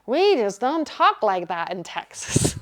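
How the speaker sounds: slow, drawn-out Texas drawl; Texan accent. Retold or imitated. Teacher imitating a student from Texas, slow Texan drawl. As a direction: attempt a stretched-out Texan accent. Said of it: "the Texan accent, but he talked like that".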